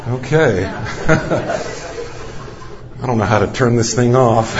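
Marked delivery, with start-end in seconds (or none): 0.7-2.4 quiet unvoiced laugh at the gadget; breathy laugh. Real, not acted. Preacher chuckling while failing to turn his watch off. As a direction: laugh softly under the breath. Watch assistant interrupts sermon.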